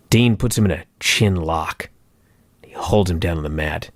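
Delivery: low, deliberately dull match recap; deadpan play-by-play. Acted. Host mocking a tame grudge match, deadpan. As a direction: recount it in a low, unimpressed monotone.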